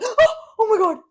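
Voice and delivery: gasp, then loud high alarm; retold panic. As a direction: act out a startled, panicked gasp.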